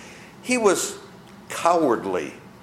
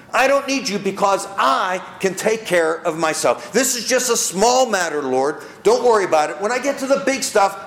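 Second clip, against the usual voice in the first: proud brush-off to God; 4 semitones higher than usual. Acted. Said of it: "Can we be proud as Christians".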